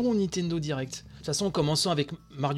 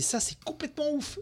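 excited; 11 semitones higher than usual. Real, not acted. Amazed at announcement.

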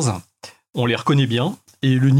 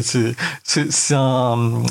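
ordinary talk

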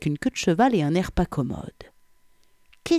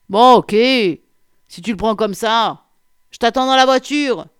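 loud, raised, put-on man's voice; rebuffed colleague calling; 6 semitones higher, 8 dB louder than usual. A narrator's reading. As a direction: shout back, giving in. Rebuffed colleague shouts his reply. (crier sa résignation, fort et plus aigu)

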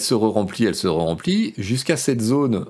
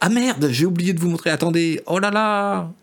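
flustered surprise; 8 semitones higher than usual. Real, not acted. Realises he forgot to show video.

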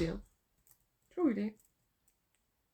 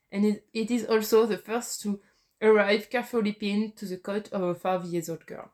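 reading English with a French accent. Real, not acted. Quiz player reads an opening line to opponent, French accent. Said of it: "pardon pour ma, mon accent anglais".

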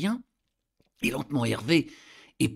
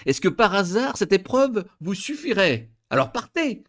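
a touch higher and louder, testing her; husband's challenge; 7 semitones higher, 4 dB louder than usual. A narrator's reading. Husband daring his wife to go home, mildly taunting. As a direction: dare her to leave, half mocking.

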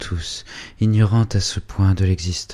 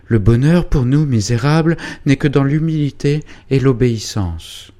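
alarmed scolding; 8 semitones higher, 5 dB louder than usual. Acted. Frightened mother scolds daughter loudly.

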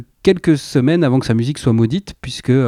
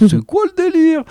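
much higher, puzzled "what is this"; baffled reaction. Retold or imitated. Host recalling seeing the album cover, high baffled mock question. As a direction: replay his own confusion at the cover, pitched way up. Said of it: "j'ai fait".

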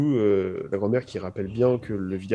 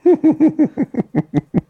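high laugh; 7 dB louder than usual. Real, not acted. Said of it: "notez sur vingt la prestation".

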